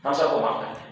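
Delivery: hushed, almost whispered repeat; soft pardon. Retold or imitated. Taliban repeating their pardon to everyone, hushed and soft. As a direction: let the pardon fall away softly, barely voiced. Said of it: "جیسے ہمارے مرشد".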